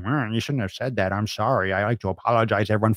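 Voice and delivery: a hint of a sorry TV talking head; quietly mocking a pundit; mock news apology. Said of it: "a talking head going".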